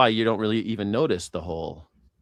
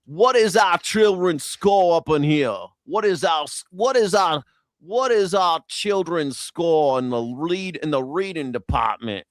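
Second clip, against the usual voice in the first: high, slow mock politician; imitating a politician's garbled grammar; lightly hammed-up politician; Mark Wayne Mullen; 6 semitones higher, slower than usual. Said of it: "Mark Wayne Mullen talk".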